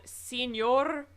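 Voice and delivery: Señor with a rolled Spanish r. Shown as a demonstration. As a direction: say Señor slowly, rolling the r. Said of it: "Did I roll my R correctly?".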